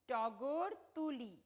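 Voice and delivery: slow, careful reading of টগর তুলি; teacher's slow model reading; slow clear model reading. Shown as a demonstration. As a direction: read টগর তুলি slowly, pausing at the full stop. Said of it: "আমি যেভাবে বলবো, ঠিক সেইভাবে".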